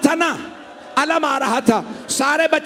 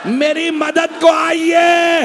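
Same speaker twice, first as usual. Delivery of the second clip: calling for help; 4 semitones higher, slower than usual. A narrator's reading. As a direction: cry out in pain for help, drawn out. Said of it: "आवाज दी". (दर्द में ज़ोर से, धीमी पुकार)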